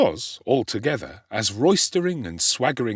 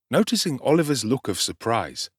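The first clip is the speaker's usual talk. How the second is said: ordinary delivery, nothing marked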